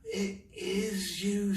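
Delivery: high, slow relieved voice; relieved girl. Acted. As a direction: breathe out the words in relief. Mouse relieved.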